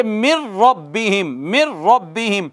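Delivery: "मिर रब्बिहिम" with a held double r, no n; इदगाम के साथ. Shown as a demonstration. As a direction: go straight from mi into a rolled ra in "मिर रब्बिहिम". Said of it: "अब पढ़ेंगे". ("मिर रब्बिहिम" में रा पर शद, नून के बिना)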